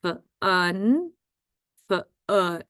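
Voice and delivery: "F-un" with the F sound held apart. Shown as a demonstration. Teacher helping first graders spell fun, sounded out. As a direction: separate the sounds of "f-un". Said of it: "Let's spell fun".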